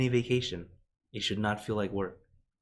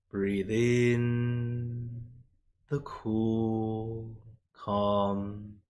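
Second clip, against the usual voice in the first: guided meditation; much slower than usual. Acted. Monk guiding the live audience into meditation, slow and soothing. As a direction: ease them into breathing slowly.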